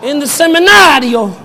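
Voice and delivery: mocking the Pope's words in Italian; mock Italian accent. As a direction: ham up a light Italian Pope. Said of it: "So he's just like".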